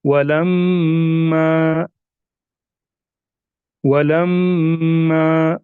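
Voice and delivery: वलम्मा very slow with a long humming म्म; slow nasalized recitation; nasal ghunna. Shown as a demonstration. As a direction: hold the म्म of वलम्मा through the nose. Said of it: "इसी तरह last में दिया गया है".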